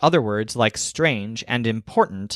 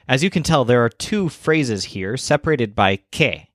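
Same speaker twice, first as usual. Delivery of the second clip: ordinary talk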